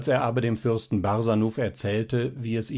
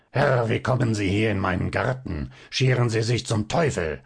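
a lightly voiced gnome's rasping snap; mild mock snarl, put-on goblin voice. A narrator's reading. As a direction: snarl at the intruder, half-hearted. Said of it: "schnarrte ihn an".

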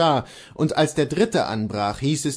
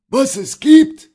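loud, high, drawn-out retort; angry echo; 12 semitones higher, 10 dB louder, slower than usual. A narrator's reading. Bailiff answers the fleeing servant, loud outraged echo. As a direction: throw the question back in outrage. Bailiff throws the question back.

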